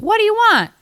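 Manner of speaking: very high, loud relayed question; first stranger's version. Retold or imitated. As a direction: yell it down the line, high-pitched. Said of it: "a little bit differently".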